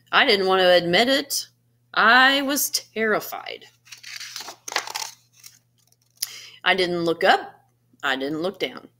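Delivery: animated picture-book narration with gentle pauses; storybook reading. Acted. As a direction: read it like story time for a class. Reads picture book to students.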